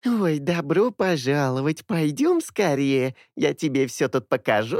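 slow, high squeaky mimicking; mocking her welcome; squeaky parody. A narrator's reading. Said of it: "писклявый голос". (писклявая пародия)

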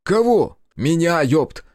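exasperated exchange. A narrator's reading. Brothers bickering over whom to cut open, exasperated high outburst. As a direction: snap in disbelief.

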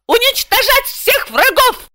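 very high, drawn-out squeal; shriek. A narrator's reading. Client ordering translator to fight her enemies, shrieking. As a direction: squeal the order slowly at the top of the voice. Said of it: "Она взвизгнула".

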